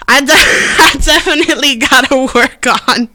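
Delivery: words broken up by laughter; laughing. Real, not acted. Host admitting weak hosting skills, laughing at herself. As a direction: laugh at yourself as you admit it.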